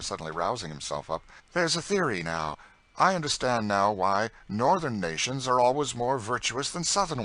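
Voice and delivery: lounging charmer teasing his aunt-like cousin; lazy teasing young gentleman. A narrator's reading. St. Clair lolls in his cousin's lap and teases her, playful. Augustine's idle banter.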